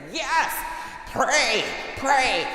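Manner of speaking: the mother begs him to pray; urgent plea. Retold or imitated. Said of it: "this mother standing right there and said".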